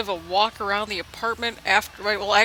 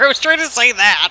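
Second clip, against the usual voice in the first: loud, amused admission; laughing; 7 dB louder than usual. Real, not acted. Laughing at his own slip.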